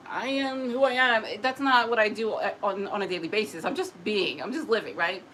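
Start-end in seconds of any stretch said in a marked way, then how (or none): 0.1-1.3 raised, declaring mock grandness; mock self-announcement. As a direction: announce yourself with put-on importance. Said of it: "I'm not out there like".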